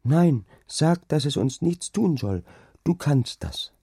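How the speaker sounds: put-on high little-girl voice, pleading; pleading child. A narrator's reading. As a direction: urge father like an anxious child. Frightened child pleads with father. (flehende, hohe Kinderstimme)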